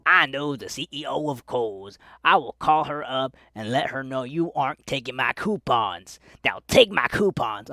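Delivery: loud, indignant mock customer; demanding customer. Customer insisting to a cashier on expired coupons, loud mock indignation.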